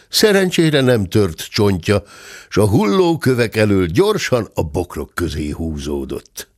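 usual speaking voice

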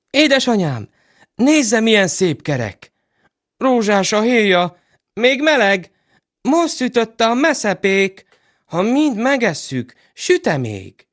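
put-on high excited little children. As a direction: chirp it like eager kids. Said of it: "children speaking, voiced high".

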